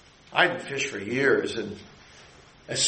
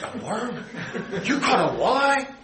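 laughing disbelief, leaping up high; can't believe it was a worm; incredulous fisherman; 9 semitones higher than usual. Retold or imitated.

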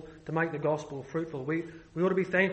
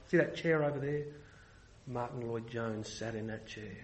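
mock reverent hush; 7 semitones lower, 7 dB quieter than usual. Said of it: "say silly things like".

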